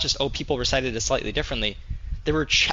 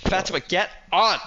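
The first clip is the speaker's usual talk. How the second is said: higher, louder call to a friend; real impatient summons, raised voice; 6 semitones higher, 5 dB louder than usual. Real, not acted. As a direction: shout for a friend to join. Calling a friend to join game.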